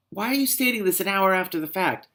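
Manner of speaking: high-pitched indignant question; exasperated heckle. Real, not acted. Reader heckles the character, exasperated. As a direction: demand it, incredulous.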